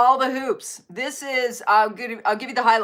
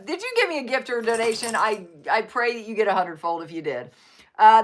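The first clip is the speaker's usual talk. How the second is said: usual speaking voice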